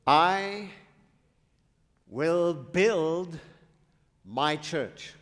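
"I" lifted, then "build" and "my" leaned on; spaced-out preaching quote; emphatic quote. Shown as a demonstration. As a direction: quote Jesus slowly, word by word. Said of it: "Who said these words?".

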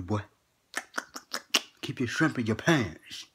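ordinary talk, nothing marked

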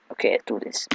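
soft whispered resolve; whispering. Real, not acted. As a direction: whisper it to yourself. Sudden unvoiced whisper.

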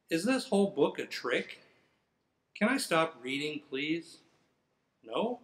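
usual speaking voice

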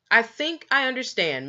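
a crisp, curt cut-in, stress on "I"; sharp interruption. A narrator's reading. Father cutting off the boy's chocolate praise, sharply. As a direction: cut the boy off briskly. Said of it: "broke in sharply".

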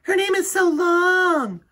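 high, loud and slow mocking girl; teasing schoolgirl. A narrator's reading. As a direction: draw it out to tease her. Jo teases Chrysanthemum.

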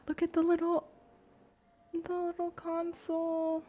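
high, cutesy coo at something tiny. Real, not acted. Gamer cooing at the little console, high and cutesy.

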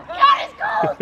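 high, laughing yelp; exhilarated, breathless-with-cold shout; cold-shock exclamation. Real, not acted. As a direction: cry out laughing from the icy water.

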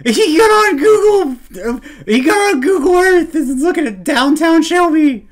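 high, loud disbelief; astonished outburst. Real, not acted. Streamer seeing his hometown in the video, astonished outburst. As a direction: exclaim it in real shock.

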